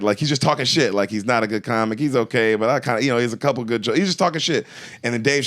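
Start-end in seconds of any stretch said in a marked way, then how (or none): none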